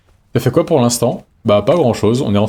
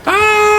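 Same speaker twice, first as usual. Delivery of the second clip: very high held "ah"; high-pitched scream of alarm; panicked squeal. Real, not acted. Climax of the panicked flight.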